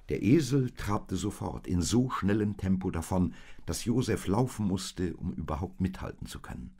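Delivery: plain reading voice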